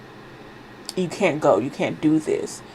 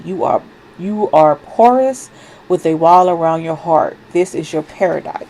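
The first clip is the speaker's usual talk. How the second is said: reading card guidance; 5 dB louder than usual. Acted. Reading the card's guidance aloud to her viewers, in a measured proclaiming voice. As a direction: read the goddess's message like a proclamation.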